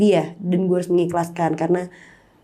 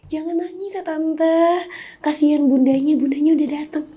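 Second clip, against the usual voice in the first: retold gentle comfort; 9 semitones higher than usual. To grieving guests at her mother's funeral, gently reassuring. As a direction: soothe a crying aunt, lightly voiced.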